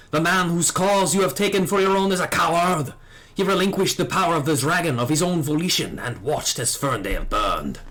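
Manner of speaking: hint of a French accent, proud and accusing; a young knight's cold contempt in a French accent. Dragoon confronting his old master, bitter French accent. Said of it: "time to rev up the French accent again".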